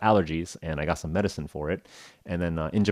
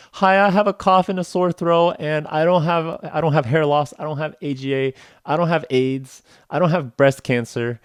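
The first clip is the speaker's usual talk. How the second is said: high, loud, urgent rattling off; hammed-up patient reciting everything he doesn't have; eager rattled list; 9 semitones higher, 6 dB louder than usual. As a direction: rush through the list to get it over with. Rattling off his usual list.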